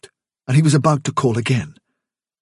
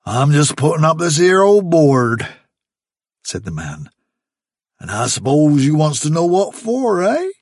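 louder country voice with dropped aitches; a sly country labourer explaining; the man to Fiver, sly rustic workman; 4 semitones higher, 4 dB louder than usual. Acted.

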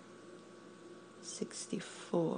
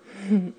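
teasing laugh; 2 semitones higher, 13 dB louder than usual. Acted. Taunting laugh during countdown game.

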